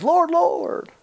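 high, loud pious cry; hammed-up fervent invocation; mock churchgoer. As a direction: imitate an eager churchgoer calling out high.

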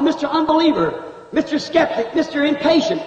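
ordinary talk

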